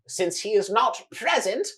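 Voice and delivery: high, loud, slow showman cry; grand announcer. Acted. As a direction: boom it out to the whole arena. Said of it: "He says".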